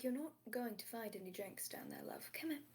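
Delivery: breathy whispered coaxing; gentle whisper coaxing Nolan out from behind the bar. Acted. Aziraphale coaxes Nolan.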